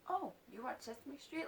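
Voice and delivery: high-pitched imitation of surprise; surprised. Mimicking his reaction to Sesame Street, surprised. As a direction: lift it into a mock-surprised question. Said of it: "come at me surprised about Sesame Street".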